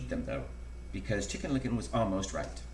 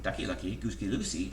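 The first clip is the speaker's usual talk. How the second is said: usual speaking voice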